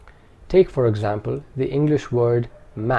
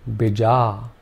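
normal talking voice

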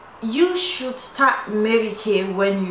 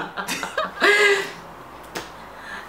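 high breathy laughter; 13 semitones higher than usual. Real, not acted. Laughing after joke.

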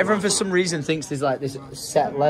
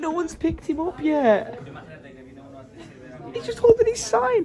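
high pitched pity; slower than usual. Real, not acted. Pity for waiting hitchhiker.

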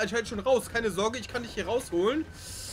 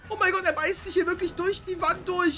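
alarmed excitement; 7 semitones higher than usual. Pretend. Streamer showing viewers the T-Rex breaking in, high and frantic. T-Rex bites through wall.

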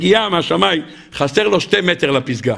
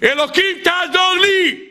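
desperate cry; 13 semitones higher, slower than usual. Acted. Climber hanging on a cliff cries out to God desperately. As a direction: scream up to God as you fall. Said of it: "ואז הוא צועק".

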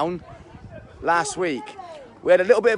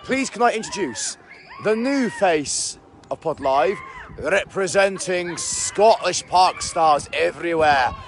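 grand announcement; slower than usual. Acted. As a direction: announce her like a game-show host. Grand introduction of new presenter.

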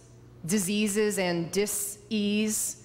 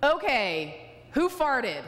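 mock-indignant toddler demand, pitched up; accusatory yell; 5 semitones higher, slower than usual. Two-year-old confronting a department store, accusatory mock yell. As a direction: call it out like a defiant little kid. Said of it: "yelling in an accusatory tone".

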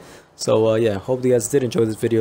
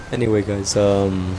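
ordinary talk, nothing marked

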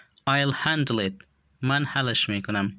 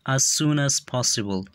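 each word said slowly and distinctly for learners; slow and clear; slower than usual. Shown as a demonstration. Teacher models English phrase for learners, slow and clear. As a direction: model the phrase slowly so students can copy it. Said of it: "به خاطر آموختن تلفظ درستشان".

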